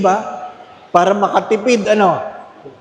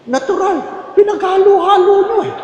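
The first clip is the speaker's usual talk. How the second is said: high, sassy comeback; mocking retort; 13 semitones higher than usual. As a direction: snap back high at the complainer. Retort to complaint about taste.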